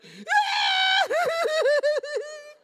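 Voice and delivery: loud high laughing wail; shrieking laugh. Real, not acted. Laughs while telling.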